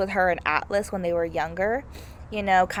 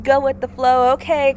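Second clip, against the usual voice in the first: airy go-with-the-flow type, pitched up; loopy; 4 semitones higher, 6 dB louder than usual. Acted. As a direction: play a loopy, easygoing friend. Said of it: "she seemed very loopy, kind of, like".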